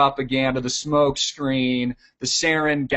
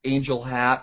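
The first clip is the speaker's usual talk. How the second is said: normal talking voice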